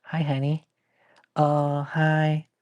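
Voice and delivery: high, cooing hello; playing a doting owner greeting a pet; sweet greeting voice. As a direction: coo at the pet. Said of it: "[name]'s all".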